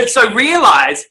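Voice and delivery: laughing. Real, not acted. Laughing after the joke.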